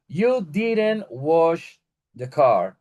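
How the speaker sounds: slow model sentence. Shown as a demonstration. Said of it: "teacher models example sentence".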